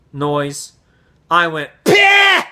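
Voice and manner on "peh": loud high-pitched mocking "peh"; really loud scoff. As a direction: blast out an exaggerated mimicking puff. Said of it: "really loud like that".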